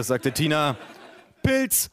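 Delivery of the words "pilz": high-pitched one-word exclamation; Ulf Udo's catchword. Acted. Recurring call of Ulf Udo.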